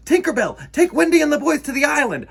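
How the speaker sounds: high, loud and fast; urgent command. Acted. Peter sends Tinkerbell away under fire, urgent. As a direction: hurry the fairy off. Pan gives urgent orders.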